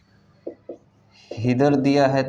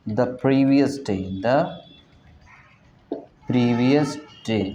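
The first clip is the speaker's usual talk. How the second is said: slow dictation; much slower than usual. Shown as a demonstration. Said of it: "dictation-like slow repeat".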